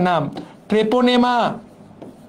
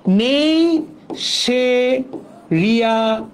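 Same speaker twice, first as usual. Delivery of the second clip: drawn-out mnemonic; 3 semitones higher, much slower than usual. Shown as a demonstration. Teacher stretching mnemonic syllables.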